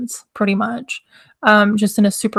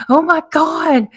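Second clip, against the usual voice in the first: excited; 6 semitones higher, slower than usual. Real, not acted. Excited greeting continues.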